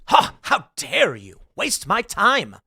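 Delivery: drawn-out mock indignation; scoff, then act affronted slowly; insulted. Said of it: "Insulted you may be".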